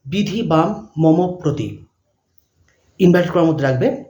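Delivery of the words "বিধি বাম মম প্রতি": dictating. Shown as a demonstration. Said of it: "প্রথম প্রশ্ন লিখবে".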